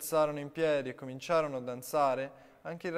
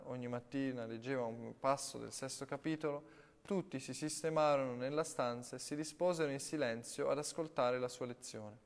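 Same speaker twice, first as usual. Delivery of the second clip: ordinary delivery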